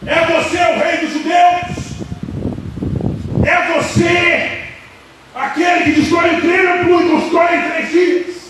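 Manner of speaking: high, loud accusing questions; forceful interrogation. Acted. Priest questions Jesus in the courtyard, loud interrogation. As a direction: fire the questions like a hostile interrogator.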